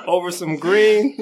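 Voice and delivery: laughing through the words, slowing. Real, not acted. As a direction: crack up while saying it.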